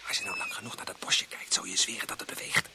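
tense unvoiced whisper; whisper, afraid of being watched; nervous hushed whisper. Fearing someone watching them.

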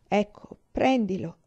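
plain reading voice

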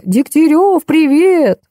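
high, drawn-out mild holler; calling out. A narrator's reading. Mother hails Degtyarev from the window, calling out high. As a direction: stretch the greeting high, as if from a window. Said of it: "весьма долго кричала".

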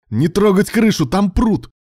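high, loud yell of horror; terrified yell. A narrator's reading. Ilya stopping rescuers sawing the roof, terrified yell. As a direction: shout a frantic warning. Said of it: "в ужасе заорал на них".

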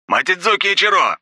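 high, loud loudspeaker call. A narrator's reading. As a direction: boom it through a loudspeaker. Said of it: "раздалось из громкоговорителя".

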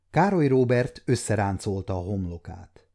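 ordinary delivery, nothing marked

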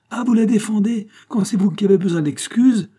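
high, loud scandalised scolding; acted angry accusation; indignant outburst. A narrator's reading. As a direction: pounce on it in outrage. Angry aunt pounces on defence.

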